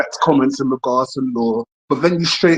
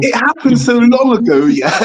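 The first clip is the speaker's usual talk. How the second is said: laughing; 9 semitones higher, 4 dB louder, slower than usual. Real, not acted. Caller laughs, embarrassed.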